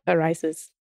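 normal talking voice

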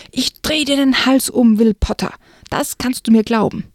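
angry threat. Acted. Girl threatens Will angrily.

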